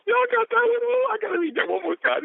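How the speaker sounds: very high, squealing hype; rally listeners in a high, thrilled voice; giddy, high-pitched enthusiasm; excited. Real, not acted. Hyped after reading quote.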